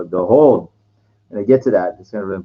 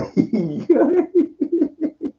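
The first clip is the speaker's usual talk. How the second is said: high laugh; 14 semitones higher than usual. Real, not acted. Laughing at own joke.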